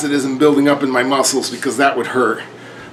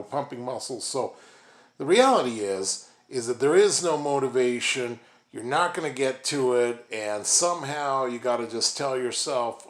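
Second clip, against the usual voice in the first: much softer, lower, hushed talk; quiet; 5 semitones lower, 11 dB quieter than usual. Real, not acted. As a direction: drop way down and murmur. Sudden much softer lower delivery.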